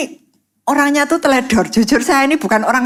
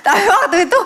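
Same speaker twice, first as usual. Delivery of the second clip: laughing; 8 semitones higher, faster than usual. Real, not acted. Laughing at own story.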